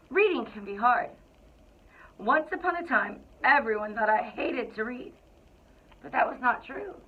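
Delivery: lively, expressive picture-book narration; storybook read-aloud. A narrator's reading. Principal reading to the Cougars, storybook narration. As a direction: read it like you're sharing a favorite book with kids.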